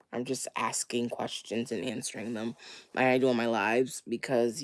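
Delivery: usual speaking voice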